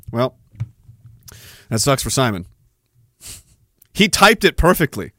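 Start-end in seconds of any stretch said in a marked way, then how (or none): none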